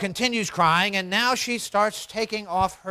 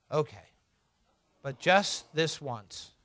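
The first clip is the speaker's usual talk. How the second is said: quiet, lower, defeated concession; giving in; 4 semitones lower, 7 dB quieter than usual. Acted. Father surrendering to his daughter's tantrum, quietly resigned.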